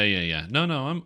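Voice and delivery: ordinary talk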